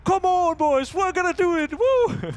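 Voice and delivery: high, loud imitation of pumped-up players; hyped team yell. Caster imitates players before the pistol round, hyped yelling. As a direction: mimic players whooping themselves up before the round. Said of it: "And they're just like".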